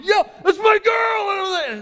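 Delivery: full-voiced shouted take; proud shout. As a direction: scream it across the field at your daughter. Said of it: "And I'm freaking out".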